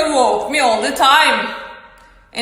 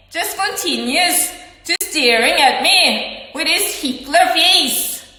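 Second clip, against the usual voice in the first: higher, exasperated rant; angry outburst; 5 semitones higher than usual. Retold or imitated. Speaker berates person who keeps staring, angry outburst. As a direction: demand an explanation in a raised, heated voice. Acted outburst over being stared at.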